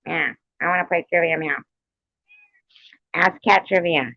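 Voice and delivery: usual speaking voice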